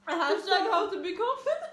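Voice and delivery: laughing. Real, not acted. Mocking the video's topic, laughing.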